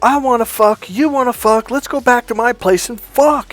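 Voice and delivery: imitating his angry outburst, high and loud; pissed-off yelling. Friend jumps up and yells at the girls, pissed-off shouting. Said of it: "pissed and he's yelling this".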